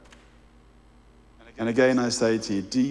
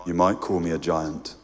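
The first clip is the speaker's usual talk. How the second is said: deep calm voice of a great lion; large deep voice; 7 semitones lower than usual. A narrator's reading. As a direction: voice a huge gentle being, low in the chest. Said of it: "asked the large voice".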